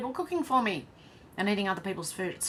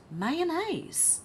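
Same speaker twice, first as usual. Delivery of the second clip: surprised; 10 semitones higher than usual. Real, not acted. First hears of mayonnaise cake.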